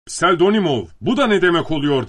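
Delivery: high, loud indignant question; shouting. A narrator's reading. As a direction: call out and demand an explanation. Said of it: "diye bağırdı". (yüksek sesle bağırarak)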